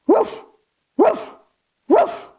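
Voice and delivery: three high yappy barks; dog barks. Shown as a demonstration. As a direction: bark like a playful puppy. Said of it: "give me three big barks".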